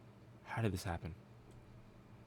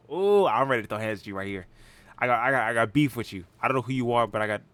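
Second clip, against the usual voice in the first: louder, picking a fight; loud and aggressive; 2 semitones higher, 8 dB louder than usual. Acted. Helmeted man threatening a stranger, loud and aggressive. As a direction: bark it at him like a brawler. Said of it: "man, he talks really loud".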